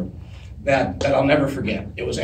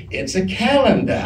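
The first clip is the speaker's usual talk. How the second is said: put-on Southern belle accent, higher pitch; Blanche Devereaux; 4 semitones higher than usual. Stand-in answers co-star in rehearsal, in a Southern belle accent. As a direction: play it with a put-on Southern accent, dropping the final r. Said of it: "I can't not do the accent".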